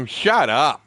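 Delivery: playful yelled retort; mock shout. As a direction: snap it back at him, half joking. Retort to buddy's teasing.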